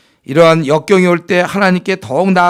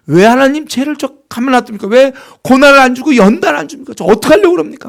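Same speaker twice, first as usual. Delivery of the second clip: higher, louder whiny protest to God; indignant complaint; 9 semitones higher, 5 dB louder than usual. Acted. Believer complaining to God about another person, high indignant whine. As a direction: indignantly demand why God waits. Mock prayer demanding others' punishment.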